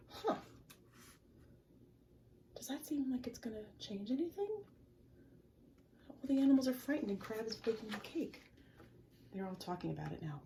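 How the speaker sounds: ordinary delivery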